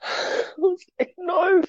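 high, broken cry of no; real tears, voice cracking upward; weeping out the word no. Real, not acted. Reliving the news, in tears.

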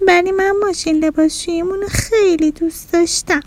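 put-on high, gentle little-kid lament; sorrowful child. A narrator's reading. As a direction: protest sadly to mom in a small child's voice. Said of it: "بچهٔ خونه با غصه گفت".

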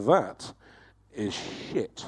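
dismissive downer. Retold or imitated. Gang being downers about a landmark.

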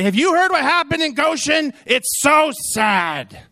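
hammed-up sorrowful gossip; mimicking the visitor. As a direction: turn her words back on her.

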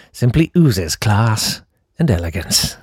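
low, slow, smooth ad-man purr; silky old TV commercial voice; 1980s voiceover. Pretend. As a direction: read it like a vintage luxury advert. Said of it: "Nineteen eighties voiceovers. Very smooth".

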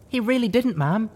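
a scared little filly speaking up; trembling little filly. A narrator's reading. Little filly defending the companion to the heroine, trembling. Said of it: "a little filly said, trembling slightly".

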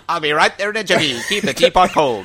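fifties radio host. Acted. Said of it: "like the radio host of the nineteen fifties".

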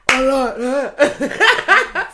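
slow, high, laughing it out; helpless giggling through the words. Real, not acted. Laughing fit after impression.

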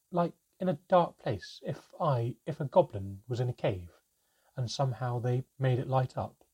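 put-on a nervous child leaning in with a question; young goblin voice.

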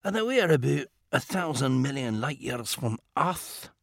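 quiet, dry and precise; a composed inventor gently scolding; calm Scottish gentleman. Acted. Mackenzie character dialogue.

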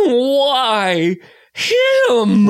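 high, stretched "Why him?" in mock anguish; dramatic father. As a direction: wail "Why him?" as a distraught dad. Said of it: "Bryan Cranston seeing James Franco and going".

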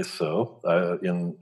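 normal talking voice

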